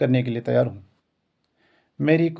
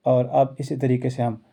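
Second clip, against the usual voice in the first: ordinary talk, nothing marked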